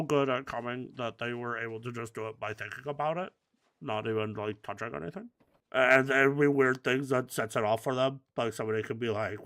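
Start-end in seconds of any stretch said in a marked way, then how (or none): none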